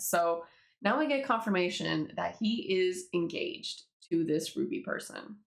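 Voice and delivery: ordinary talk, nothing marked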